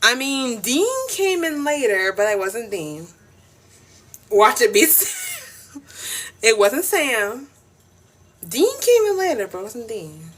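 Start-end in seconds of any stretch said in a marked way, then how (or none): 4.3-5.8 slow, laughing through it. Real, not acted. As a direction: giggle the name out. Laughing guess.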